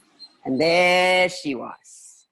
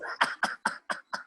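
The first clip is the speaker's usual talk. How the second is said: witch cackle; 6 dB quieter than usual. Acted. Witch laughs.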